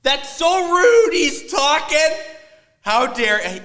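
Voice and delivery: high, loud mock-scolding mother; scolding mom voice. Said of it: "she doesn't want me getting up".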